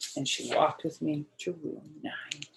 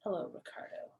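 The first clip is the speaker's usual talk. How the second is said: soft whispered hello; 13 dB quieter, faster than usual. Acted. Girl whispering to her boyfriend, sweetly. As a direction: breathe it sweetly at him. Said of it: "very attractive".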